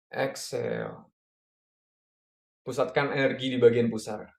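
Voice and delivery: calm breathing coach cues; guided breathing. Acted. Leads a breathing exercise.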